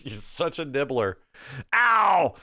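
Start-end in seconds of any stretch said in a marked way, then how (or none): 1.7-2.3 short yelp of pain, pitched up and louder; a real yelp as teeth sink in; sharp ow. Real, not acted. Puppy bites his finger.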